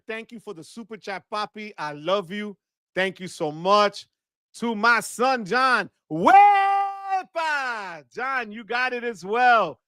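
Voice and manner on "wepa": celebratory holler. Real, not acted. Streamer hailing a super chat donor, celebratory holler. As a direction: belt it out, holding the first syllable.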